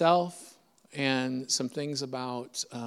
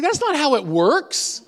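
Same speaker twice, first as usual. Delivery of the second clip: higher, slower, louder disbelief; incredulous protest; 10 semitones higher, 5 dB louder, slower than usual. Retold or imitated. Dad hears the doctor say go now, higher, slower incredulous protest.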